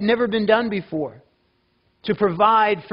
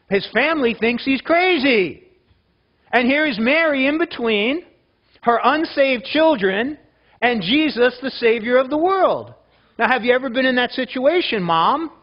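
raised, loud pulpit preaching, pressing on; preaching at full force; 6 semitones higher, 4 dB louder than usual. Acted. Pastor pressing the mothers in the congregation, preaching loudly and higher. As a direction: drive it home to the moms in the room.

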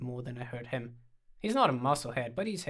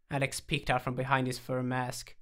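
usual speaking voice

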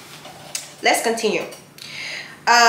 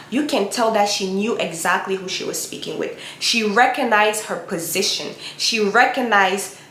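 normal talking voice

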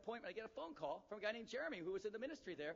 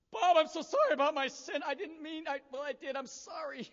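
high, loud mock wail, easing off; imitated tearful confession; 9 semitones higher, 10 dB louder than usual. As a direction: act out a sobbing public apology. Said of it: "He starts crying about all of his sin".

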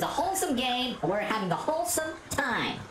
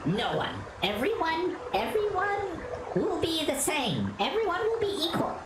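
calm ship computer; 7 semitones higher than usual. Acted. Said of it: "I sound like a computer A I".

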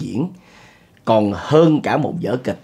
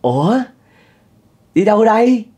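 mock surprised greeting; 10 semitones higher, 5 dB louder than usual. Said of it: "ổng kiểu vậy nè bạn".

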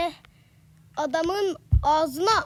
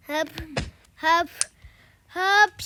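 sneezing; slower than usual. Pretend. Hapşurma öncesi yükselen nefes. (giderek yükselen hapşırık hazırlığı)

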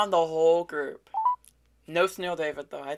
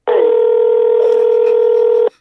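loud breathy burst of laughter; 9 dB louder than usual. Real, not acted. Youtuber laughing hard at the recognized scammer.

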